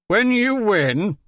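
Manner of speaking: mock the very idea of him winning; mocking scoff. A narrator's reading. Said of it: "Jovan laughed".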